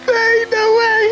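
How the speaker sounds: high, loud scream of disbelief. Real, not acted. Streamer shrieking in disbelief at the wreck. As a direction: shriek it in real shock.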